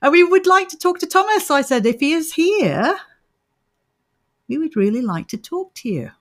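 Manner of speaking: mock-stern séance summons. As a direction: address the spirit firmly. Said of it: "that's stern".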